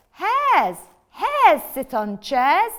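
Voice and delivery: slow, loud, flat refusal; bossy cat. Acted. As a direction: drag it out, not budging. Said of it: "said the cat".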